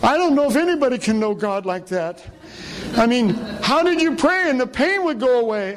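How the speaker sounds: high-pitched quote of the injured woman; woman in pain. Retold or imitated. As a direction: act out her disbelief in a high voice. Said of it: "and she's in such pain. She says".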